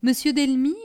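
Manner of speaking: ordinary delivery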